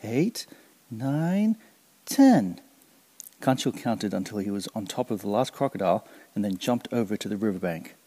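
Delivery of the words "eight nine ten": rising higher to a loud finish; excited counting. Acted. Kancil counting the last crocodiles, rising to a loud ten.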